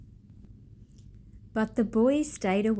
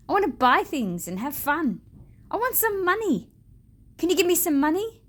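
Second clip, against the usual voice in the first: put-on eager boy; 7 semitones higher, 4 dB louder, faster than usual. A narrator's reading. Boy demanding money from tree.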